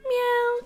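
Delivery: cat meow. Retold or imitated. Meowing at the cat.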